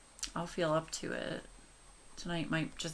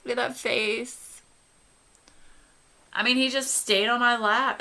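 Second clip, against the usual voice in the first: higher, louder gush; 6 semitones higher, 8 dB louder than usual. Real, not acted. Vlogger showing viewers the sleeping dog, excited gush. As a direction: show off the cute dog.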